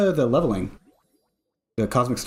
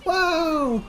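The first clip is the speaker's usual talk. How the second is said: amazed; 14 semitones higher than usual. Real, not acted. Awed reaction to game.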